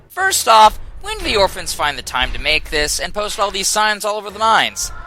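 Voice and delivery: ordinary delivery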